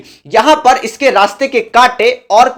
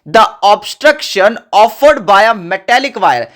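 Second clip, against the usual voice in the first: very slow, word by word for note-taking; deliberate dictation pace; much slower than usual. Shown as a demonstration. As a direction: spell the definition out for students to copy. Reading definition out for notes.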